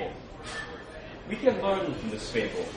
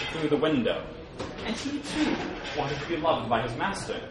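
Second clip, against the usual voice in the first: usual speaking voice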